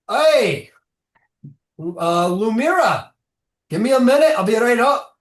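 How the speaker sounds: high, loud, happy call up to a friend; dwarf greets an old friend, cheerful and loud; brightened call. Acted. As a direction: call out gladly from below. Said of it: "he brightens".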